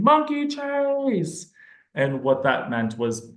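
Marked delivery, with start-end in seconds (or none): 0.0-1.5 high, drawn-out imitated call; mock child's scream. Kiddo screaming at the therapist to play, a long high call. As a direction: stretch it like a kid shrieking, half-hearted. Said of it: "just screamed at me".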